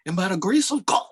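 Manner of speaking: high-pitched mock televangelist pitch; lightly mocked infomercial cry; screaming infomercial preacher.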